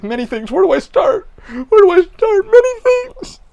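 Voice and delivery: escalating hysterical shriek, slowing at the end; manic shriek. Retold or imitated. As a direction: wail it like an unhinged weirdo. Joking manic answer to stranger.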